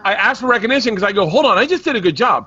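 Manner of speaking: high, loud, worked-up protest; indignant rant. Real, not acted. Arguing with family over praise for growth, indignant. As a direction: plead your case at a high-pitched near-yell.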